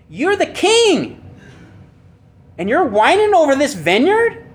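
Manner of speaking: mock her disbelief at a whining king; scolding rebuke. Preacher voicing wife's scolding rebuke.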